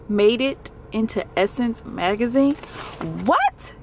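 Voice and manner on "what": very high, rising exclamation; amazed disbelief. Real, not acted. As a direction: let the pitch leap in disbelief. Amazed at her magazine feature.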